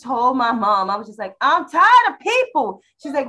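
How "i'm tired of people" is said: higher and louder, drawn out slowly; exasperated cry to mom; mock exasperated outburst. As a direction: blurt it out fed up with everyone. Telling mom she's tired.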